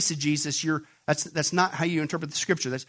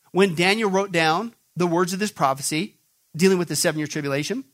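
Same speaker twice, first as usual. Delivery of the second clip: normal talking voice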